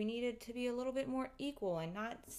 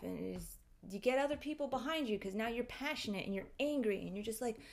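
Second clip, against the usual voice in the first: normal talking voice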